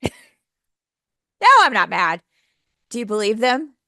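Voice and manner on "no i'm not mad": huffy denial. Retold or imitated. Teacher imitating a huffy friend denying anger. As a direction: snap it like an obviously annoyed person. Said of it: "heard someone say".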